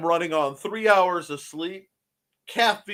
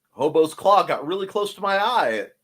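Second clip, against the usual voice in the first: normal talking voice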